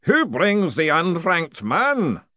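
slow, loud ceremonial challenge; drawn-out booming ritual voice. A narrator's reading. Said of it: "a voice boomed".

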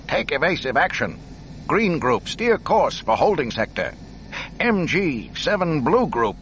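high, loud urgent commands; urgent orders. Acted. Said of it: "spoke urgently".

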